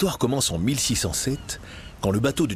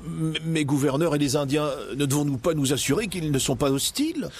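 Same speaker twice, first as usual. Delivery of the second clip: hesitant captain; 6 semitones higher than usual. Acted. Captain questions governor.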